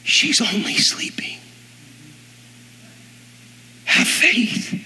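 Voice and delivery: soft whispered reassurance; whispered promise to a grieving father; hushed Jesus. Acted. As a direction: lean in and breathe the words gently. Jesus speaks softly to father.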